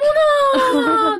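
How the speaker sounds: high drawn-out 어머나, loud and slow; a delighted cry of surprise, stretched long; surprised gasp. Real, not acted. Host seeing the couple student ID, surprised cry.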